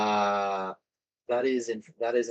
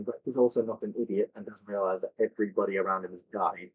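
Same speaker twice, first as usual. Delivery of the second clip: ordinary talk, nothing marked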